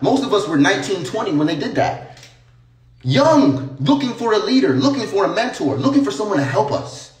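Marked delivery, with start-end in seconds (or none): none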